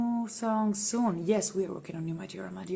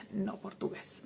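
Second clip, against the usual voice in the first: whisper; 8 dB quieter than usual. Acted. Teasing reply to a fan.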